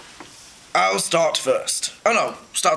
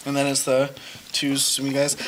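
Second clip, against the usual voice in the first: ordinary talk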